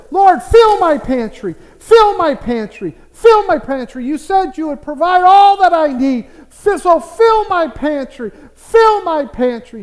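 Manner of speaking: acting out a widow's insistent prayer; fervent prayer. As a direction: pray it loudly, pleading over and over. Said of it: "she would pray this prayer".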